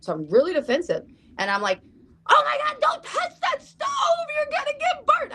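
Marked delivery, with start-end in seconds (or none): 2.3-5.3 put-on high-pitched, frantic scream at a child; panicked scream. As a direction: scream it out in alarm, much higher. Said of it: "I scream at my kid".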